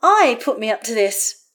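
loud, mildly indignant protest. A narrator's reading. Karlach answering Astarion's suspicion, protesting. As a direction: stress the first word in protest. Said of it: "Karlach protests".

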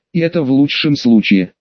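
usual speaking voice

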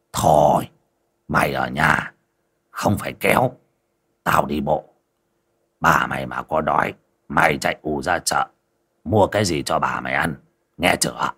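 lightly voiced old man dismissing his servant; old man shooing. A narrator's reading. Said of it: "lão Đớp xua xua".

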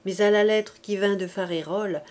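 usual speaking voice

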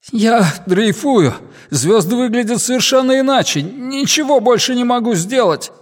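high, helpless voice of someone adrift; distressed. Acted. Adrift major telling flight control he can do nothing, distressed. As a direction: report helplessly, close to despair.

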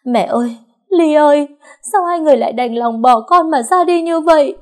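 very high, loud grieving wail; wailing and crying. Acted. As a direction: wail at the coffins, voice breaking. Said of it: "nhà mà gào khóc".

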